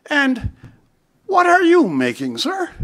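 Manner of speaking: a gentle royal query that lifts up high at the end; queen's polite question. Retold or imitated. The queen asks the factory worker, politely and lightly voiced. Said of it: "I can't do the accent".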